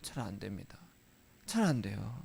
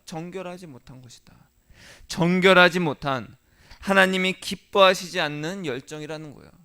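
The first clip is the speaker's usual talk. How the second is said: usual speaking voice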